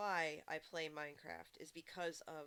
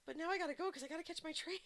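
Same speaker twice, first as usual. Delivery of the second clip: higher, fast, laughing; laughing retort; 10 semitones higher, 4 dB louder, faster than usual. Retold or imitated. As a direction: rush it out, laughing. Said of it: "I was like".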